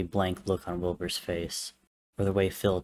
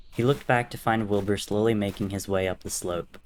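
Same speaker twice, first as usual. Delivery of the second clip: ordinary delivery, nothing marked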